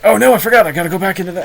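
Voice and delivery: high, loud flustered self-quote; mock panic. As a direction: act out suddenly remembering, voice jumping up. Acts out panic at dropped accent.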